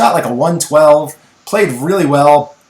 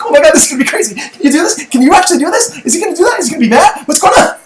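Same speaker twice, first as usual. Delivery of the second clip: put-on high, giddy kid voice, much higher and louder; excited kid; 13 semitones higher, 3 dB louder, much faster than usual. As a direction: squeal it like a thrilled eleven-year-old. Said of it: "he's like".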